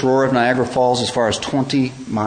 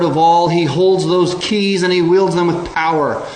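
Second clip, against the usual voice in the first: louder and higher with each line, building; preaching at full force; 5 semitones higher, 5 dB louder than usual. Acted. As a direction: drive it home like a revival preacher.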